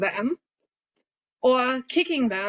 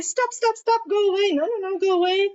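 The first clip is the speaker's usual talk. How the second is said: high, thin, pleading voice from the head; 9 semitones higher, 3 dB louder than usual. Shown as a demonstration. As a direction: say it up high, weak and scattered. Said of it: "as opposed to talking from your head".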